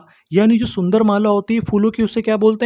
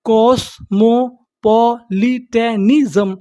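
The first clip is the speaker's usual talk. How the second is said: कॉस्मोपॉलिटनिज्म very slowly, each syllable held apart; slow teaching breakdown of कॉस्मोपॉलिटनिज्म; much slower than usual. Shown as a demonstration. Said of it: "तो meaning क्या बनी हमारी".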